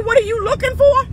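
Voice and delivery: high, quick mocking echo of his question; mocking a man's question. Mimicking a date on the first phone call, high and fast. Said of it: "As soon as he say".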